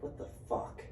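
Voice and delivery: breathy whisper, no voice at all; whispered disbelief. Retold or imitated. Seeing the dad won't fit in the tree stand, stunned whisper. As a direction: mouth it under your breath in shock.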